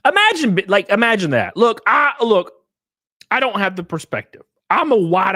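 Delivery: high, loud, worked up; excited rant. Real, not acted. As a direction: launch into the rant. Heated rant.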